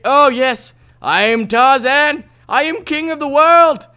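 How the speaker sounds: slow, high, loud victory cry; triumphant yell. Pretend. Gamer on top of a tree, crowing his triumph slowly and loudly. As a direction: bellow it from the treetop like a hero.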